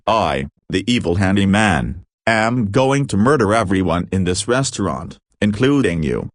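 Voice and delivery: ordinary delivery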